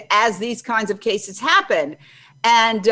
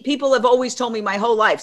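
normal talking voice

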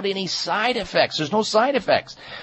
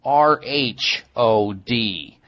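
spelling it out; 6 semitones lower than usual. Shown as a demonstration. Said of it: "it's actually spelled".

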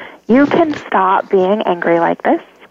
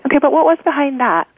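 higher-pitched, quick probing question; acted inner questioner, quick and higher; self-questioning; 7 semitones higher, faster than usual. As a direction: ask yourself briskly, pitched up. Acting out a self-questioning exercise.

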